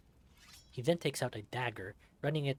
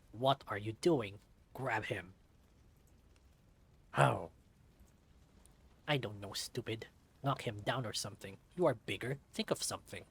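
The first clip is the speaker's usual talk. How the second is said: bossy villain; 2 semitones lower than usual. Acted. Boss scolding henchman.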